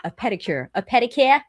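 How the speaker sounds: a hint of an old Irishman asking; old Irish man. Old Irish man asking the salon, lightly Irish-accented. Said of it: "He's just like".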